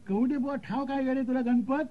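high rustic voice, rising question; calling local. Acted. Paan seller asking Ganpat on the bench, high rising call. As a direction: toss the question across in high pitch.

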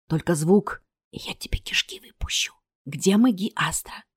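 menacing whisper at knifepoint; low, whispered intimidation; hissed threat. A narrator's reading. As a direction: hiss the threat so nobody else hears. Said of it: "прошипел он, касаясь живота".

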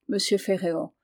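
plain reading voice

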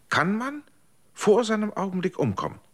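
startled question. Acted. Condemned man asks his friend if one can die early, alarmed. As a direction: blurt it out in alarm, voice jumping high.

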